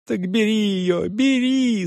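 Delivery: put-on high, drawn-out girlish plea; begging. A narrator's reading. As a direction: implore urgently in a high voice. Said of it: "стала умолять девочка".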